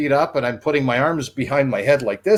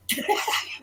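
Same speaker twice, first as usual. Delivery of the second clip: soft, wheezing laugh; re-enacted dying laughter; 8 dB quieter than usual. Colleague almost falling off the chair, soft wheezy laugh. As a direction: laugh as if out of air. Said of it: "I'm laughing. I'm laughing already".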